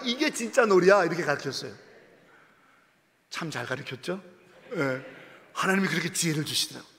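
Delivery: usual speaking voice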